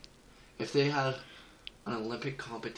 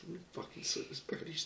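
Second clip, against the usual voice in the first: whisper; 6 semitones higher, 8 dB quieter than usual. Real, not acted. Muttering at the game.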